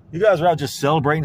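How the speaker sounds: ordinary talk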